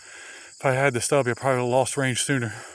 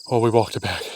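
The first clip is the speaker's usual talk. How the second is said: ordinary delivery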